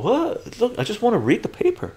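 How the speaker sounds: high-pitched, pleading protest; baffled protest. Acted. Streamer playing himself challenged over wanting the data, baffled protest. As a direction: protest innocently in a high, quick voice. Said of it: "I'm going".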